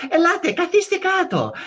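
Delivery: high, loud Greek welcome; excited cousin in Greek. Retold or imitated. Cousin inviting Australian relatives to sit, high and loud. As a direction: usher them in, gushing. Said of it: "it switches to Greek and she goes".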